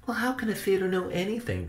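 high, quick, hushed character voice; quiet high-pitched wondering; inner thought. A narrator's reading. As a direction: puzzle it to yourself, softly and fast. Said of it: "Benny thought".

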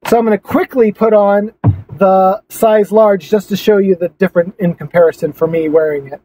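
usual speaking voice

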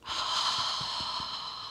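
long audible breath out on ha; breathy ha exhale. Shown as a demonstration. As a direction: sigh it all out on ha. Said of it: "exhale with the sound ha".

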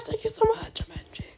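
breathless whisper of gratitude; whispered thanks. Real, not acted. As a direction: whisper it close to the mic. Whispered thanks after loud one.

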